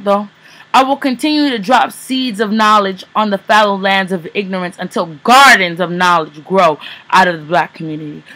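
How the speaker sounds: forceful, measured slam-poetry delivery; spoken word poem. Acted. Poet performs Whitewashed to an audience, impassioned spoken word. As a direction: declaim it like a poet on stage.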